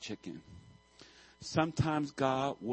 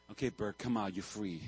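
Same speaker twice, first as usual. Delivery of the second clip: gentle release; 5 semitones lower, 7 dB quieter than usual. Retold or imitated. Gently freeing the chick.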